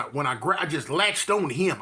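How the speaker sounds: ordinary talk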